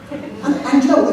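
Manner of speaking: usual speaking voice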